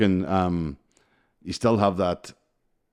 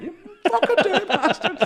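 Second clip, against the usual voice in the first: very high, squealed retort with laughter; mock outrage; 5 dB louder than usual. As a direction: squeal the insult in comic indignation. Retold outraged retort to insult.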